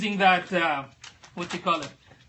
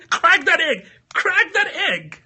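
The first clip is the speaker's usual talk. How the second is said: high, slow laughing chant; giggling mock rallying cry; 9 semitones higher, 4 dB louder, slower than usual. Real, not acted.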